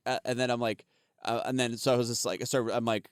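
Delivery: ordinary talk, nothing marked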